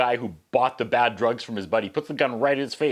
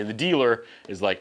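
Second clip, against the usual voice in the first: ordinary delivery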